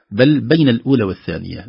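ordinary talk

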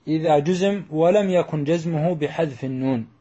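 ordinary delivery

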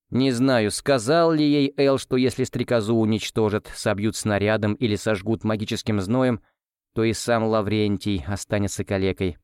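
plain reading voice

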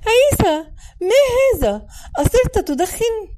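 high, loud, piercing scolding; shrill shocked sister catching him out; sharp, annoying shout. A narrator's reading. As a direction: cry out in a sharp, irritating voice. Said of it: "فصاحت بصوتها الحاد المزعج".

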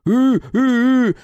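loud muffled wail; gagged wail. A narrator's reading. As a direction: howl through a gag. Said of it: "взвыл пленник".

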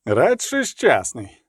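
slow, deliberate correction; serious. A narrator's reading. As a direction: say it gravely and unhurriedly. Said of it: "з серйозною міною".

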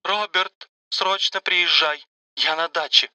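a hint of a hollow, tearful woman; muffled tearful voice. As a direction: plead hollowly, near tears. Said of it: "глухим голосом попросила Мария и заплакала".

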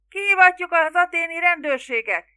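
high, loud cry; shout from a crowd. A narrator's reading. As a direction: call it out over the crowd. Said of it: "kiáltotta valaki". (magas hangú, erős kiáltás)

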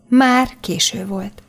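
ordinary delivery, nothing marked

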